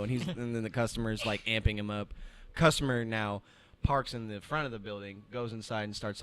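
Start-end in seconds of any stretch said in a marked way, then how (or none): none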